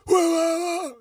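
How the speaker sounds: high loud wah wah mocking the yelling cops; mock yelling noise. Driver mocking cops yelling at him, high squawk. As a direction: squawk like the cops barking at you.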